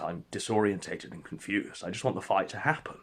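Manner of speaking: usual speaking voice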